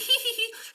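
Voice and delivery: high falsetto giggle; witch's titter. Acted. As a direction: titter at the knight's boast.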